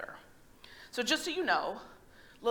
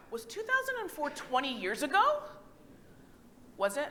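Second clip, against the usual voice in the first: retold disbelief; 4 semitones higher than usual. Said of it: "and thought".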